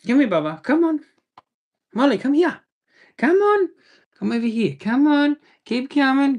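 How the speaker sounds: high coaxing dog-calling voice; sing-song call to coax the puppy over. Real, not acted.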